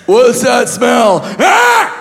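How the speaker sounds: high, slow manic yell; intense waking shout. Pretend. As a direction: wake up shouting wildly. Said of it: "That would have been way more intense".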